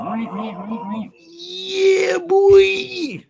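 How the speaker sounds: high crying squeal; mock wail. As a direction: wail it in fake despair. Reacts to losing an arm.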